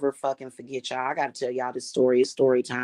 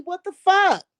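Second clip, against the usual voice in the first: high, loud burst of disbelief; outraged exclamation; 4 dB louder than usual. Real, not acted. Ranting at the liars, a high outraged yelp. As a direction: fling it out, fed up.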